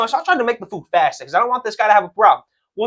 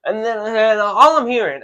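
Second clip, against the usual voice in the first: ordinary talk, nothing marked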